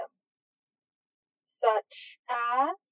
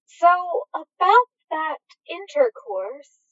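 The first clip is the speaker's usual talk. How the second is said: higher, prim and teasing; coy; 6 semitones higher, 4 dB louder, slower than usual. A narrator's reading. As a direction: raise it with prim mischief. Aziraphale coyly raising the topic.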